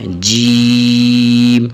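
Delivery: جیم held long, one madd; long sung-out جیم; drawn-out jeem. Shown as a demonstration. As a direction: stretch جیم slowly to its full length. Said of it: "یہاں جیم کو تھوڑا سا کھینچنا ہے".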